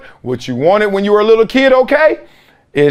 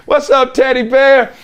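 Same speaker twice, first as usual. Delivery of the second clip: greedy relative; 6 semitones higher than usual. Retold or imitated. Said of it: "That's what they call me".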